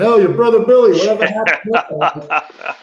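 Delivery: bright mock call from a passing fan with a laugh; fans yelling the line. Passing fans shouting his old line at the actor, mock call. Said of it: "walking by saying".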